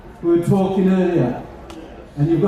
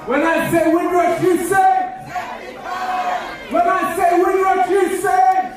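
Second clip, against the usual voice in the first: energetic shouted call; 10 semitones higher than usual. Shown as a demonstration. MC rousing the Windrush crowd, shouted call. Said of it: "I want a bit of energy, a bit of life".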